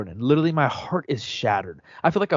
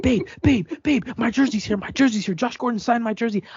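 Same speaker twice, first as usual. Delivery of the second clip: much higher, thrilled calling out; excited yelling; 11 semitones higher than usual. Retold or imitated. Fan retells calling his partner about the jersey, thrilled and high. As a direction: shout the news like a kid on Christmas.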